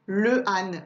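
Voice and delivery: "le âne" with le and âne kept apart; wrong unelided article. Shown as a demonstration. Teacher shows what not to say, "le âne" unelided. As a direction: say "le âne" without eliding the vowel. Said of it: "on dit pas".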